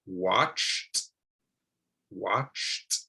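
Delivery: watched slowly, the T ending clear; enunciated ending. Shown as a demonstration. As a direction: say watched with a crisp T. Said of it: "I'm making sure to enunciate my words".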